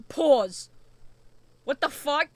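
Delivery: loud, mock-stunned outburst; shocked chat reaction. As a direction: imitate a stunned chat, loudly. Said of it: "everyone in the chat was like".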